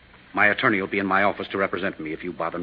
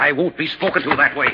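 indignant retort; 7 semitones higher, 6 dB louder than usual. Acted. Doctor offended by insult.

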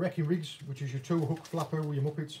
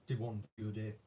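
ordinary talk, nothing marked

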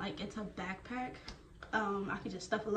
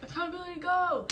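high mock drill shout, rising higher; imitating a drill instructor, much higher; drill instructor call; 12 semitones higher, 4 dB louder than usual. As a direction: chant it like a drill sergeant. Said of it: "So he's gonna be like".

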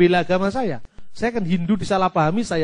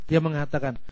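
ordinary talk, nothing marked

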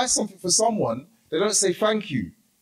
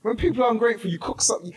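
ordinary talk, nothing marked

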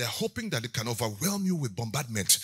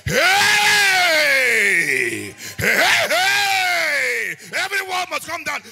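long, high full-force battle yells; shout of faith; 13 dB louder, much slower than usual. Shown as a demonstration. Preacher demonstrates shouting alone in his car, full-force shout of faith. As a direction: scream it out at the top of your voice. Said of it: "this kind of shout".

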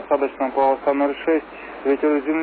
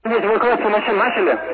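tense, raised controller voice; urgent emergency radio call; 6 semitones higher than usual. Real, not acted. Controller during emergency evacuation.

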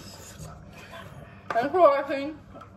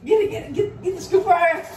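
excited urgent; 8 semitones higher, 3 dB louder, faster than usual. Real, not acted.

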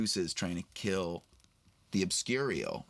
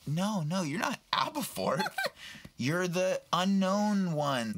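excited reveal; 9 semitones higher, slower than usual. Retold or imitated. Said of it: "she was like".

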